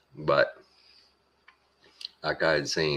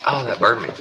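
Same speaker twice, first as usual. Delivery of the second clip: startled by the heat; 8 semitones higher, 6 dB louder than usual. Real, not acted. Burnt by hot food.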